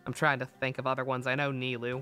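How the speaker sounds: normal talking voice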